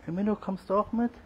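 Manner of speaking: high coaxing call. Real, not acted. Calling cat to come along.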